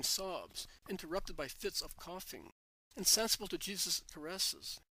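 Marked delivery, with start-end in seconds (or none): none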